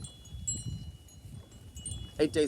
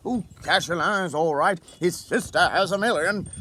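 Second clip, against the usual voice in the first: higher, louder mimicking of gossip; mocking gossip voice; 7 semitones higher, 6 dB louder than usual. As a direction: imitate the office gossip. Mocking what colleagues say.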